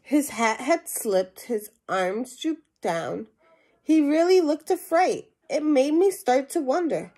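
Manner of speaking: lively storybook reading to a class; animated read-aloud voice for young listeners; reading to children. Acted. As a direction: read it out like a picture book for kids. Teacher reads picture book aloud.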